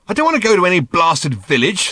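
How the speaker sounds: loud, high irritated snap; snapping. A narrator's reading. Ford rejecting Arthur's plan of the village, snapping. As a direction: snap it out in exasperation. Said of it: "snapped Ford".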